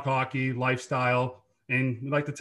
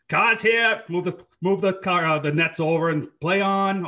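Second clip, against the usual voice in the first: street hockey call; 4 semitones higher, 5 dB louder than usual. Retold or imitated. Kids calling out in street hockey.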